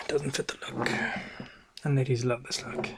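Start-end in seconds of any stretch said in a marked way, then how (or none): 0.1-0.9 a weary sigh, then a half whisper. Acted. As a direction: breathe it out like a tired confession. Sighing aside to the audience.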